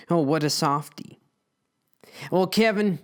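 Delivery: ordinary delivery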